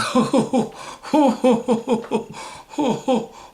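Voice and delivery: high, hooting mock laugh in long runs; imitated odd laugh. Date laughing at his stories, imitated high hooting laugh. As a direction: imitate her laugh as repeated high hoots. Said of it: "she was literally laughing like this".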